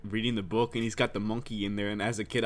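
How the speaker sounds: ordinary delivery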